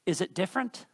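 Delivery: usual speaking voice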